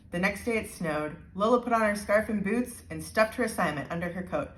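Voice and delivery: ordinary delivery, nothing marked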